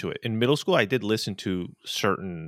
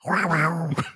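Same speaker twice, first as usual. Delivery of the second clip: growling tiger voice; 7 semitones higher than usual. Pretend. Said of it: "Hit me with a tiger".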